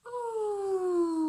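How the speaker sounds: high sustained "Ooh"; ooh sound demo. Shown as a demonstration. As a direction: call out a long "Ooh". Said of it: "and say".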